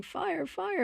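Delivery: high-pitched mock alarm yell; retold yell. Retelling George seeing smoke at the daycare, high mock yell. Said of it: "he starts yelling".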